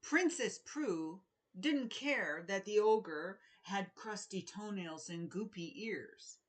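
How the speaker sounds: ordinary delivery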